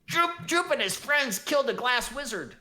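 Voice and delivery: high, excited little goblin voice; goblin Droop. Acted. Goblin boasting to the innkeeper, high excited voice. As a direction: squeak it out proudly like a small goblin. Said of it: "Droop says".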